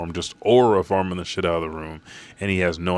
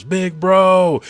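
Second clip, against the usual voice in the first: very high, loud anguished yell; 12 semitones higher, 8 dB louder, much slower than usual. Retold or imitated. Brook yells over his brother's body, anguished scream. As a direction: scream his name through tears. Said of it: "he yells".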